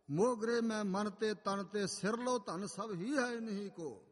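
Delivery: slow, slightly lifted verse recital; measured, drawn-out Gurbani recitation; scripture recitation. Acted. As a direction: proclaim the holy verse, stretching each phrase.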